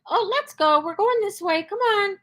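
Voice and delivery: high, bright cheerful call to the dog; happy upbeat. Shown as a demonstration. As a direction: sing it out happily to your dog.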